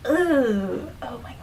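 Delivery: grossed-out ooh, soft and airy; hushed, recoiling reaction; retold disgust. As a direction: act out being quietly grossed out. Acts out disgust at spit on hand.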